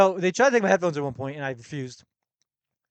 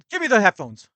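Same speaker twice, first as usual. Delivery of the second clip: loud, higher demand from a police officer; officer's demand; 6 semitones higher, 8 dB louder than usual. Retold or imitated. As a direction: bark the order like a checkpoint cop.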